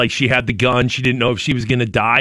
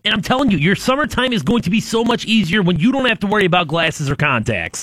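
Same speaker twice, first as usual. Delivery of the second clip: ad read; 5 semitones higher than usual. Acted. Host reads a radio ad.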